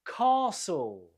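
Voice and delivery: "Castle" with a long ah, stressed on the first syllable; long vowel, first-syllable stress. Shown as a demonstration. Teacher models the word, long stressed ah in "Castle". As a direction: draw out the ah in "Castle", silent t. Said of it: "we have a long vowel sound".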